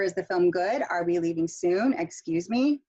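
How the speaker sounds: questions climbing on "good", "soon" and "me"; rising intonation. Shown as a demonstration. Teacher modelling yes-no questions, rising intonation. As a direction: swing each final word upward. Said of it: "for rising intonation".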